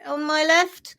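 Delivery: high, loud friendly hello, slow on the second line; lightly voiced cartoon cell saying hi; chirpy papillae greeting. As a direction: call out cheerily to a neighbour. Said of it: "They're like".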